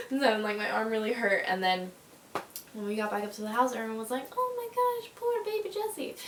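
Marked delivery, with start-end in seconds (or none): 4.4-6.1 put-on baby-talk pity for the injured kid; cooing relatives. Family fussing over injured Jessie, high pitying coo.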